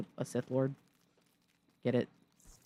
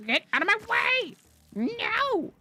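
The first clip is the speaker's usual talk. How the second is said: very high, squeezed protest; urgent squeal; 12 semitones higher than usual. Real, not acted. Streamer out of stamina and blocked, urgent high squeal. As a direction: squeal at whatever blocks you.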